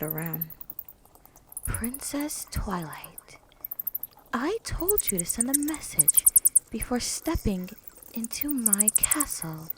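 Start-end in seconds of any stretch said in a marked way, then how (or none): 1.7-9.7 hushed, echoing vampire-filly whisper; eerie whisper. Acted. Ruby scolding Twilight unseen, eerie whisper. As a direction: whisper it from the shadows. Ruby's hidden voice.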